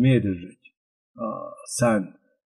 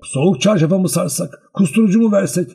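higher and louder, nervous questions tumbling out; a frantic father rising in pitch with each remedy; tense, anxious; 8 semitones higher, 4 dB louder than usual. A narrator's reading. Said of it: "diyordu gergin bir halde".

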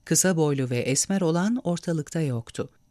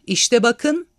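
loud, higher triumphant shout; 7 semitones higher, 6 dB louder than usual. A narrator's reading. Civilian shouting at the crowd as he waves a newspaper. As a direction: wave the paper and yell. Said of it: "diye bağırdı".

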